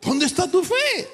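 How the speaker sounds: high, whiny mock scold; mocking believer. Church member scolding the preacher about faith, high and whiny. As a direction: whine it like a know-it-all.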